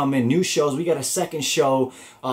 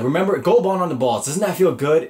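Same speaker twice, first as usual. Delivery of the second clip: higher and louder, pushing the point; insistent buddy; 5 semitones higher, 5 dB louder than usual. Retold or imitated. Sean pressing RJ about Gold Bond, louder and eager. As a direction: sell it hard to your friend.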